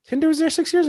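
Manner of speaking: high, astonished disbelief; can't believe it; surprised. Real, not acted. Disbelief at six years.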